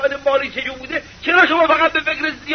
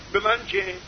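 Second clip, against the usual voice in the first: dismissive mutter; 5 semitones lower, 7 dB quieter than usual. Retold or imitated. Preacher mocks the stingy man's reply.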